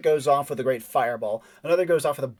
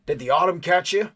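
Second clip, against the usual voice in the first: stranger's voice; 4 semitones higher, 4 dB louder than usual. Acted. Stranger calling to hidden PC.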